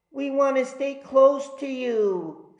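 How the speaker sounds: put-on a small boy clinging to Mom; child voice. A narrator's reading.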